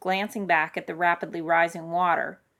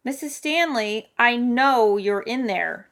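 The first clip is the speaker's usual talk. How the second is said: urgent raised voice, drawn out; acted yell, higher and slower; 5 semitones higher, 6 dB louder, slower than usual. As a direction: call slowly and loudly to someone indoors. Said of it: "Dominic yelled".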